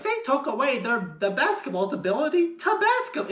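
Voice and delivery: high cartoonish voice; silly, high-pitched character line; goofy character. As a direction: quote it like a goofy movie character. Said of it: "goofy character saying it".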